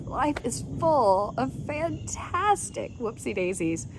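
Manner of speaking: slow, soft playful lilt; lightly playful. Acted. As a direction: savor the catchphrase gently. Catchphrase line, slowed.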